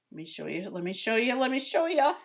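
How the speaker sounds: growing eagerness to show it off; eager, building. Real, not acted. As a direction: build excitement with each repeat, rising in pitch and volume. Eager to show viewers something.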